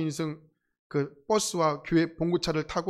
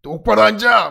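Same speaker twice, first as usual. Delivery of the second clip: loud shouted order; yelling pastor; 3 semitones higher, 9 dB louder than usual. Retold or imitated. Senior pastor shouts at the campers, loud command. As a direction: bark it at the sleepy kids. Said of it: "왜 이렇게 소리를 지르시는지".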